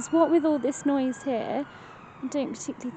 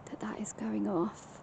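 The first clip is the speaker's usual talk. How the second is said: whispering; 2 semitones lower, 11 dB quieter than usual. Real, not acted. Hushed at spooky device.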